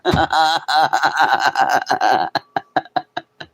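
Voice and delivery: bursting into high laughter; loud high laugh. Real, not acted. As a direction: laugh hard at your own joke. Laughing at own bit.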